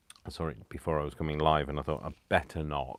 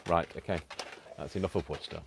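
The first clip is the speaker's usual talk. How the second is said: usual speaking voice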